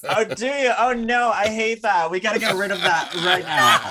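high-pitched playful protest; mock dismay. Guest hears of their Dutch accent, mock dismay.

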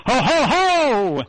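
slow booming Santa laugh; belly-laugh it like Santa; jolly ho ho ho. Pretend. Santa laugh after Christmas wishes.

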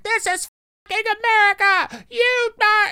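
very high screechy British impression; mock high-pitched scream in a British accent. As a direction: imitate a pop star screaming in British. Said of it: "screaming in a [name] British accent".

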